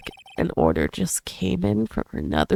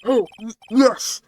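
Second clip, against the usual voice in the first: ordinary talk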